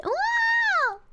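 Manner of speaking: very high, stretched mock wow; cartoon squeal of wow. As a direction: gasp it out in fake awe. Mock amazed shout.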